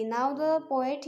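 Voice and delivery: normal talking voice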